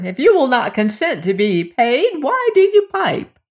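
mother's question rising high at the end; a hint of a scolding mother; lightly voiced mother. A narrator's reading. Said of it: "cried his mother".

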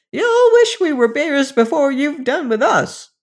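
gleeful shrill creature warning; shrill threat. Acted. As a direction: menace the children in a shrill voice. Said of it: "cried a shrill voice".

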